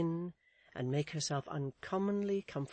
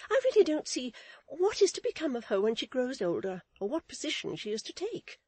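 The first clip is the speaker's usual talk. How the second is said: high, loud, fussy character voice; fretting matron; 9 semitones higher, 5 dB louder than usual. Acted. Mrs Chick despairs of her niece, fretting. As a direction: complain in a pitched-up matronly voice.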